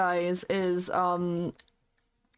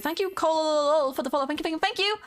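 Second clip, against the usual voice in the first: high excited thanks; 7 semitones higher than usual. Real, not acted. Thanking a follower.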